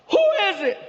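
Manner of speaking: high shouted question through the door; mock yell. G yelling through the door at the knockers, high mock yell. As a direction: bark the question high and sharp. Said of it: "He yells".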